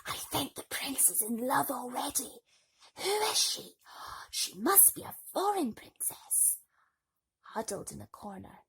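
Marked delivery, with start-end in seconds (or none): 0.1-6.6 hushed, excited ballroom gossip; gossiping whisper. Acted. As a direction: whisper it behind a fan, rising on who is she. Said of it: "Women whispered behind their fans".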